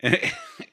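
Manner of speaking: sudden very high squealing laugh. Real, not acted. Host cracking up at the hyena joke, high squeal. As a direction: let a shrieking laugh burst out.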